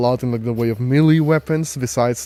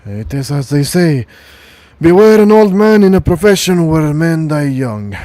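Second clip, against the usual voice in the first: put-on gruff old soldier; 4 semitones higher, 9 dB louder than usual. GM voicing Sergeant Lucas.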